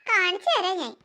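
low, soft scheming vow under the breath; muttered threat. Acted. Jealous girl vowing revenge on her rival, low soft mutter.

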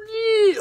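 high, loud whoop of joy; joyful yell. Real, not acted. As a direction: let out a happy shriek at the pull. Big pull, shouts in joy.

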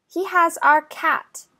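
usual speaking voice

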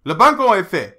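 loud French croupier announcement; casino croupier. A narrator's reading. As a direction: call it out across the table. Croupier announces bet covered.

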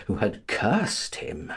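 ordinary delivery, nothing marked

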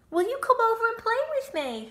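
high, fast, eager piglet voice; pleading piglet. Acted. Wilbur asking the goose to play. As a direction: ask hopefully like a little pig. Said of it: "he asked".

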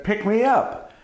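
high-pitched calling voice; small, high plea from out of sight; unseen voice calling. Acted. Unseen voice calls again.

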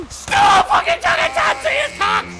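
high angry yell; raging gamer. Real, not acted. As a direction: shout at the screen. Yelling at game.

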